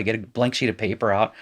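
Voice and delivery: normal talking voice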